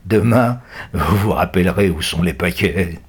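gloating captor; sneering. A narrator's reading. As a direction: taunt the captives. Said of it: "ricanent les gitans".

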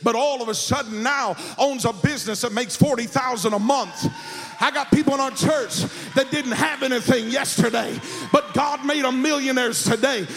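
full-force preaching. Real, not acted. Preacher tells congregation they are blessed, not cursed, full-force preaching.

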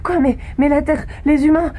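high, rising panic; alarmed and disbelieving; shocked. Acted. Shocked to hear Earth's fate.

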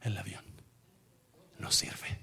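very soft, low and halting; almost whisper it, with a pause midway; hushed bad news. Radio telling José the plane is broken, hushed.

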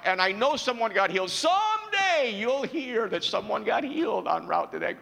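high, loud, stretched preacher's cry; preaching at full force. Acted. Preacher pleading to hear Jesus' heart, soaring preach.

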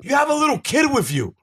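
high, indignant mock lecture; retold defiant refusal. Acts out his defiant reply.

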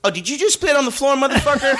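high, fast mock confrontation; imitating the security guard calling him out; hammed-up bouncer scolding; mock angry bouncer. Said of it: "he just goes".

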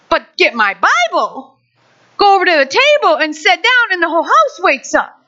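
usual speaking voice

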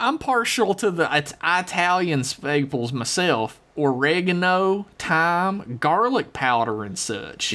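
country drawl, chatty and folksy; folksy hillbilly letter-writer. Acted. Host reading out a listener's question, in a country drawl. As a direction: read it as a rambling backwoods yokel. Reads listener email in character.

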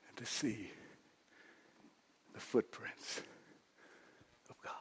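quiet reflective preaching; hushed reflection. Acted. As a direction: bring it down to a near whisper. Preacher drops to reflective hush.

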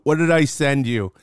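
usual speaking voice

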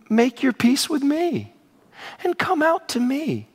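slow, slightly softened invitation; coaxing offer. Acted. Envoy tempting the besieged to surrender, coaxing. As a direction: lure them out gently and slowly. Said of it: "Rabshakeh says, glad you brought that up".

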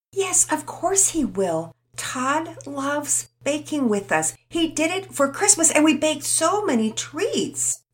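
put-on slow, careful girl voice, reining in her speed. Penelope answering Tommy about Todd, slow and deliberate. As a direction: measure each word out on purpose. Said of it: "trying to modulate the speed".

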